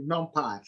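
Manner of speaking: NumPy with the ending said "pie". Shown as a demonstration. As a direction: say NumPy with a long "eye" at the end. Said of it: "we call it NumPy".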